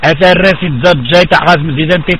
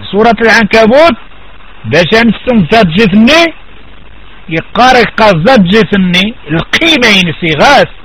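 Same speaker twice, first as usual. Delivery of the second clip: ordinary delivery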